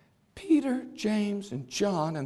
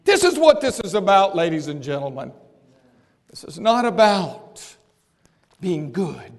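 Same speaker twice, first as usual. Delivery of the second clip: ordinary delivery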